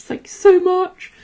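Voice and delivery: over-the-top high pet voice gushing about dinner; squeaky delighted pet. Pretend. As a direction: squeal it like a thrilled puppy thanking its owner. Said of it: "they'd be sort of like".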